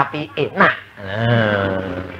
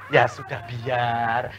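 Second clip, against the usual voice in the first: resigned giving in; 6 semitones lower, 8 dB quieter, much slower than usual. Retold or imitated. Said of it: "dimaki-maki suami".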